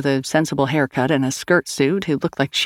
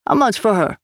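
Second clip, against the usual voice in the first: higher Swedish lilt, casual bargaining; Scandinavian sing-song; 6 semitones higher than usual. A narrator's reading. Swede asking Sal the price of Grace, Scandinavian lilt. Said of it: "up-and-down sing-song of Scandinavia".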